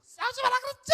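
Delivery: high, screamed heavy-metal scolding; head-voice shouting. Retold or imitated. Wife scolding her fighting daughters, high screaming shout. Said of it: "두성을 쓰고 있는데?".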